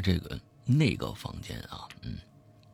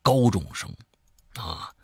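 lively storyteller swinging between hushed and emphatic; spoken horror-story narration; dramatic storytelling; 5 semitones higher, 4 dB louder than usual. A narrator's reading. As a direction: tell a creepy tale with punched key words. Tells a horror story aloud.

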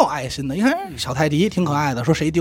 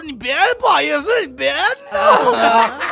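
mock pleading dog; 9 semitones higher, 4 dB louder than usual. Dog squeals at the man touching him.

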